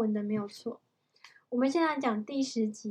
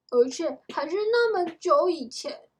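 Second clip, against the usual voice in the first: raised, loud voice of wonder; amazed emphasis; 5 semitones higher, 8 dB louder than usual. A narrator's reading. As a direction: marvel out loud as a story character. Jack goes on, amazed.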